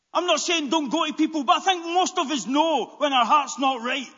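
high, shouted sermon exhortation; full-force preaching. Real, not acted. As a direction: cry it out urgently to the congregation.

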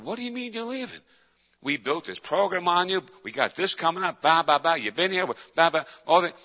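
high-pitched mock tirade; retold angry yelling. Boss berating his friend for leaving, retold yelling. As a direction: act out an angry boss's rant. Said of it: "He started yelling at me".

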